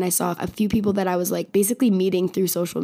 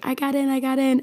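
higher-pitched, re-enacted good news; retold excitement; 7 semitones higher than usual. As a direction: lightly imitate excitedly telling friends. Retells telling friends the news.

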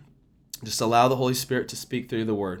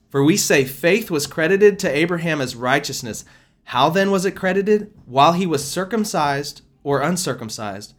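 louder, lifted Bible-reading voice; scripture reading; 3 semitones higher, 4 dB louder than usual. Acted. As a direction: read the verse out with weight to the congregation. Reads scripture aloud.

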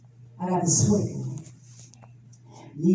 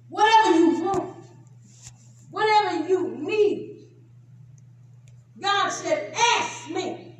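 rising, louder and higher preacher's push; preaching build; 11 semitones higher, 4 dB louder than usual. Acted. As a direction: build the sermon to a cry. Preaching climax.

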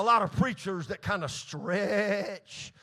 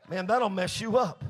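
ordinary delivery

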